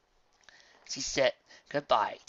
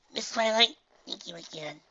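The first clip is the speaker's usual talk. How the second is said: sincere; 10 semitones higher than usual. A narrator's reading. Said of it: "she said sincerely".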